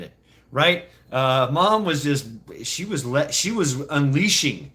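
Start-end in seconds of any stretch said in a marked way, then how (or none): none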